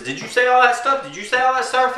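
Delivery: very high mock accusing neighbor; Jarney. Neighbor demanding answers about the video, high mock accusation. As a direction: press the question in a high mimicking voice. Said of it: "this is my impression of him".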